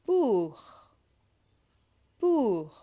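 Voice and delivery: "Pour" with a stretched French oo; oo vowel demo. Shown as a demonstration. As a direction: model "Pour" as poor, loud and high. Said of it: "oo sound".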